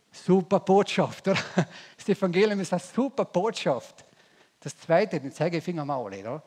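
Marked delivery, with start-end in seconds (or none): 0.1-2.0 excited. Real, not acted. Excited definition of gospel. 2.0-4.1 loud, high exclamation on super; excited. Real, not acted. Excited definition of gospel.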